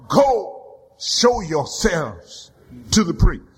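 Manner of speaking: slow, low command; Jesus sends the lepers away. Acted. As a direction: lay down the command with weight.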